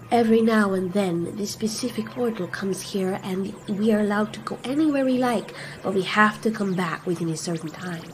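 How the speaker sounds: gentle Scylla. Acted. Scylla counsels Godzilla, soft and measured. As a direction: speak quietly and carefully as the gentle one.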